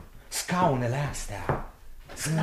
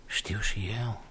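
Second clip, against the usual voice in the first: breathy doubtful whisper; unsure whispered aside; 4 semitones lower, 5 dB quieter, slower than usual. Acted.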